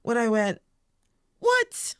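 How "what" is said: very high-pitched, mock-outraged reaction; acted-out astonishment, pitched way up; incredulous. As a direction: squeal it in disbelief. Acts out her reaction at forty.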